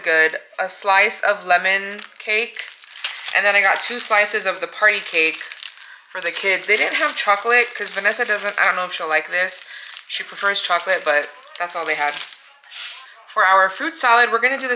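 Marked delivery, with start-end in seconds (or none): none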